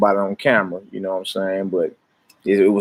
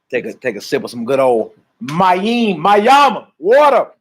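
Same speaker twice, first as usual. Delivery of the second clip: climbs high and loud into the last word; rising call; 5 semitones higher, 4 dB louder, slower than usual. Real, not acted. Leader calling the congregation to drink, rising loud. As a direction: lift the voice as he calls out water.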